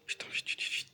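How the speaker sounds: rapid hushed urging, almost voiceless; urgent muttering. Real, not acted. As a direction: hurry it along under your breath. Urging himself on during game. (chuchotement pressé, à peine audible)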